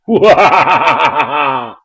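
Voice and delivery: loud booming villain laugh; menacing god laugh. Pretend. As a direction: let out a big loud evil laugh. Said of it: "look menacing like this".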